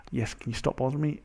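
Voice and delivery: ordinary talk, nothing marked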